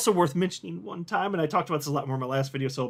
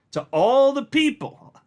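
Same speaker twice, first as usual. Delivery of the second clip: higher and louder, slowed right down on all; hyped-up call out; 9 semitones higher, 6 dB louder, slower than usual. Real, not acted. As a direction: shout it out to the whole crowd of doubters. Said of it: "I'm gonna repeat it".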